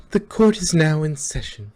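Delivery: loud, formal court call; formal announcement. Acted. Twilight opening court, loud and formal. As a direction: proclaim it to the whole court. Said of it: "Twilight announced".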